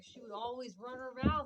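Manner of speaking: ordinary delivery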